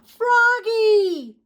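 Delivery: high calling yell; dad yells to his wobbling son. Acted. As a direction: call out to him in alarm. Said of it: "he yelled".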